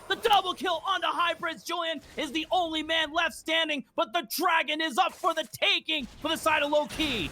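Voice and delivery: fast, high, excited esports commentary; live play-by-play. Real, not acted. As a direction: call the fight at full speed.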